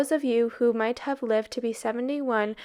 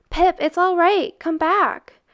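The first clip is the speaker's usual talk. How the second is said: high, loud call after him; 7 semitones higher, 6 dB louder than usual. Acted. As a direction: call him back up the stairs. Boys calling after fleeing Pip.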